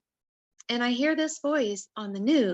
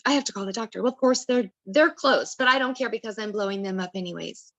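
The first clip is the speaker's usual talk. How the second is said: usual speaking voice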